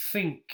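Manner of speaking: Think. con th sorda, sin vibración; voiceless th. Shown as a demonstration. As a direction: deja salir el aire en Think. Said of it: "But you do feel air".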